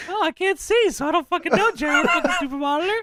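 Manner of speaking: high, grumbling mock whine; Jerry Lawler. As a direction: gripe in a squeaky commentator voice. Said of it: "Jerry Lawler being mad about his".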